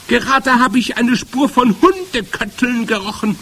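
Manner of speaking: loud, high witch screeching. A narrator's reading. Witch reports the trace she has smelled, screeching. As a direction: shriek the alarm to the whole room. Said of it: "kreischte sie".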